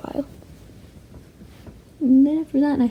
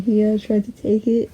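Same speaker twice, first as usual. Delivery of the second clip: normal talking voice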